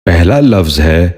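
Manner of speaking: normal talking voice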